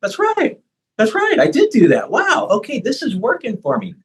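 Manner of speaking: high, delighted "That's right", rising; thrilled to notice their own progress; client realizing, excited. Retold or imitated. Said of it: "they're thinking like".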